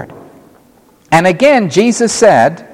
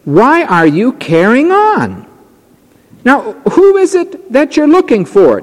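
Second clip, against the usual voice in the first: voiced Jesus; 10 semitones higher than usual. Preacher voices Jesus to Mary.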